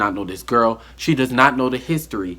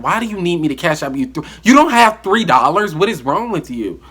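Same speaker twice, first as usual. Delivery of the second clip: higher and louder on the middle question; exasperated disbelief; 7 semitones higher, 6 dB louder than usual. Retold or imitated. Ranting to viewers about the three-dollar excuse, louder and higher. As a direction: let the outrage spike on don't.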